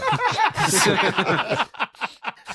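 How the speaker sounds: long, high-pitched real laugh; helpless laughter. Real, not acted. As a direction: crack up completely. Cracks up at the joke.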